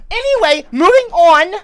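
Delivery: high, very loud; angry shout. Real, not acted. Streamer trying to move on, still shouting. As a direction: force the topic change through clenched anger.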